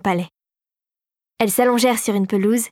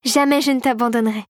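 ordinary delivery, nothing marked

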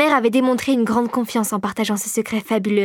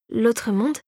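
puzzled echo, rising on MONDE; taken aback. A narrator's reading. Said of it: "répéta Clara interloquée".

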